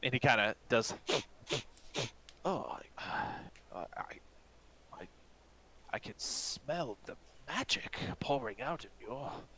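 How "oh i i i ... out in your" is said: hushed, softer, a touch higher, half whispered in wonder; awed old elf. Acted. The apothecary, awestruck, hushes at the sorcerer's magic. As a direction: breathe it like you sense great power.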